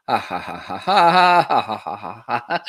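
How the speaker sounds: swelling ha-ha test laugh; staged laugh. Shown as a demonstration. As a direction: fake a hearty laugh into the mic.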